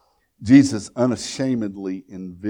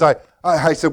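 ordinary talk, nothing marked

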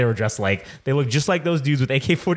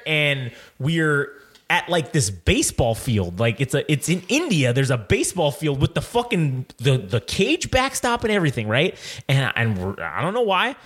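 ordinary delivery

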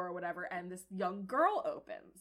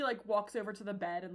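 ordinary delivery

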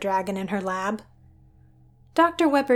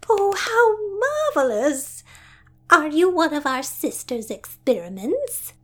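delighted gushing; 7 semitones higher, 4 dB louder than usual. Acted. Sister meeting the dragon, clapping with delight. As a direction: gush like a delighted aunt. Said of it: "With a series of tiny hand claps".

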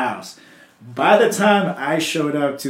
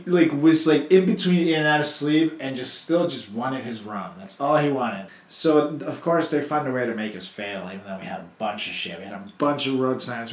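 ordinary delivery

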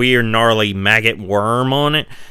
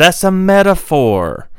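priest's dismissal; 7 semitones higher, 4 dB louder, slower than usual. Retold or imitated. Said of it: "And he's like".

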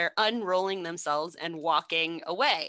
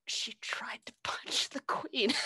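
giggly secretive whisper; laughing whisper; 3 semitones higher, 6 dB quieter than usual. Retold or imitated. Whispered gossip breaking into laughter.

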